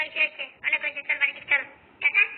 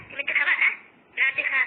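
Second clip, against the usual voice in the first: usual speaking voice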